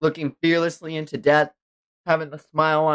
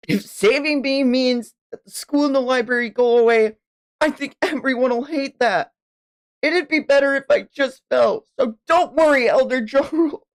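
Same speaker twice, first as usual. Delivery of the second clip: put-on high, earnest child voice; brave young child; 10 semitones higher than usual. Child telling an elder not to save them, high and earnest. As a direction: reassure the elder in a kid's voice.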